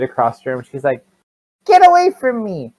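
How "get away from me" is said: put-on high, loud child's outburst; angry child. Child refusing the captor's food, angry high shout. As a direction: yell it like a defiant little kid.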